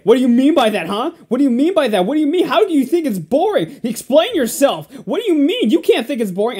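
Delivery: hammed-up confrontational grilling, pitched high; indignant demand. Acting out a critic challenging an opinion, indignant demand. Acting out hostile argument partner.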